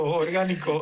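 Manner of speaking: orgánico with a long stretched first o, teasing; drawn-out wink. Shown as a demonstration. Host teases that the ad was natural, drawn-out ironic orgánico. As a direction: stretch out the o in orgánico like a knowing joke. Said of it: "¿Qué tal, qué tal el comercial?".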